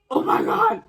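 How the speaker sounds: high, loud strained shriek; mock scared cry. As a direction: cry out in acted terror. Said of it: "I was so scared".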